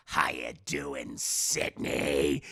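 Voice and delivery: very low, slow mock killer voice; hammed-up deep villain on the phone; menacing phone killer. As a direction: drop deep and drag it out to taunt.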